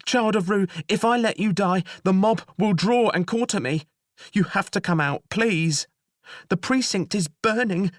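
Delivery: high, loud begging; desperate plea. Acted. Jailer begging the children to leave, high and frantic. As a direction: beg them out, high and frantic.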